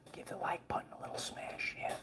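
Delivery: secret whispered aside; conspiratorial hushed plea. Acted. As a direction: lean in and whisper the request. Whispered request to like.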